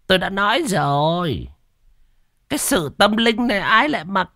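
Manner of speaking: put-on high-pitched, drawn-out huffy old master; haughty refusal in a high put-on voice; annoyed shaman. A narrator's reading. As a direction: stretch the words out high, as if offended. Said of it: "Thấy thầy có vẻ tức giận".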